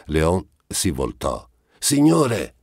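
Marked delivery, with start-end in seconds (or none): 1.8-2.5 high, loud panting call; sudden breathless shout after the pair. A narrator's reading. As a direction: call out to him, out of breath from running. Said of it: "un ansito affannoso".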